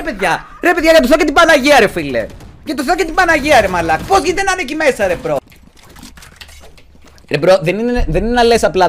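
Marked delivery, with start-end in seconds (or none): 0.6-5.1 exasperated plea. Real, not acted. Exasperated pleading with teammates.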